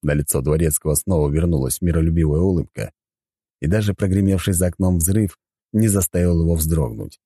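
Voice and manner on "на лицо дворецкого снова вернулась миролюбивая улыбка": usual speaking voice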